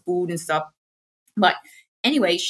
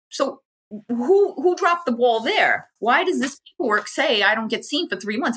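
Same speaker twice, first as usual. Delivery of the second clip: exasperated; 5 semitones higher than usual. Retold or imitated. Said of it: "We're like".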